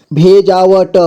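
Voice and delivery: भेजावट with final ट sounded separately; syllable by syllable. Shown as a demonstration. Teacher breaking down भेजावट for learners, syllable by syllable. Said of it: "जोड़ जोड़ करके".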